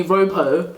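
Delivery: Ropo with a long first O, like "rope"; mispronounced name. Retold or imitated. Vlogger quoting how adults get her name wrong, mispronounced Ropo. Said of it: "than they did in primary school".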